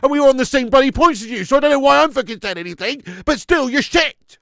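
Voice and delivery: loud mocking jibe at a rival fan; gloating taunt. Real, not acted. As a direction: rub it in, loud and cocky.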